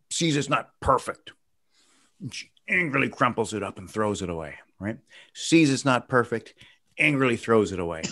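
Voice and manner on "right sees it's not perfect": ordinary talk, nothing marked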